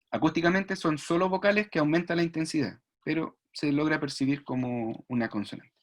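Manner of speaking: ordinary talk, nothing marked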